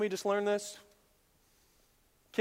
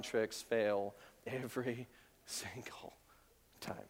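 whispered; 11 semitones lower, 13 dB quieter than usual. Real, not acted. Whispered punchline.

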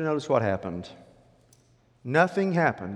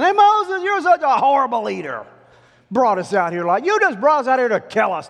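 high, accusing mock outburst; loud complaining; 12 semitones higher, 10 dB louder than usual. Israelites complaining to Moses about his leadership, accusing. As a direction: lay into the leader at full voice. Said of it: "they began to complain about Moses".

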